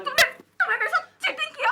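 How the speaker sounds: high-pitched wail of betrayal; hurt accusation. Acted. Betrayed woman accuses her lover, hurt. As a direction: wail it out, heartbroken.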